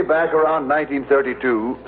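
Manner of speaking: usual speaking voice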